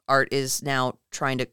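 ordinary talk

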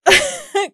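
burst of laughter. Real, not acted. Laughs at the slang.

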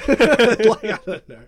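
laughing through the words; giggling while talking. Real, not acted. Laughing about doing an accent.

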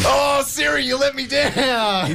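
shouted, high, slow mock lament; mock despair. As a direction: wail it like a betrayed friend. Siri picked the wrong answer.

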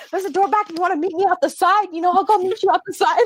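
high, quick mock threat to fight; mock tough challenge. Coworker daring the rude customer to fight outside, high mock taunt. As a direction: dare her to step outside, half laughing. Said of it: "She's like".

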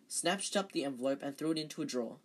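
usual speaking voice

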